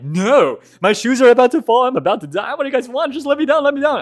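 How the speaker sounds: high, loud panicked begging; panicked plea. Retold or imitated. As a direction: beg to be let down in panic. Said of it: "I'm like".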